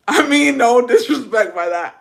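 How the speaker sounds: a loud, oddly voiced apology that comes out wrong; odd loud take. Shown as a demonstration. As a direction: blurt the apology out loud and weird. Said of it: "I don't know why I said that like that".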